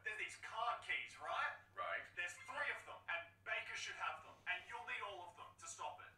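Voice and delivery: high, pleading captive scientist voice. Acted. Captive scientist to Snake, high and pleading. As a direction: beg the rescuer to get you out. Donald voice.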